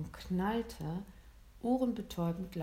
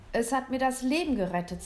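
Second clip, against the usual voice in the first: raised, louder voice of amazement; amazed exclamation; 5 semitones higher, 6 dB louder, faster than usual. A narrator's reading. Father discovers what saved his life, amazed.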